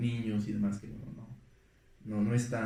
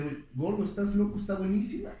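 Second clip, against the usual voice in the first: entusiasmado; 9 semitones higher, faster than usual. Retold or imitated. Alfonso le responde a Guillermo entusiasmado.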